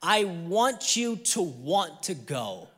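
very slow, spelled-out demand; imitating wife. Wife explains what she wants from husband, pointedly slow. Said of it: "This is how she said it".

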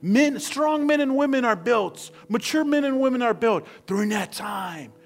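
high, loud, urgent exhortation; full-force preaching. Real, not acted. Preacher urging daily time alone with God, impassioned. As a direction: drive every phrase home to the congregation.

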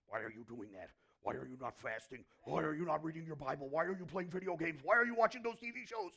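accusing questions building to a loud shout; hammered-up scolding, swelling in volume; judgmental scolding. Acted. Acting out a judging person's scolding.

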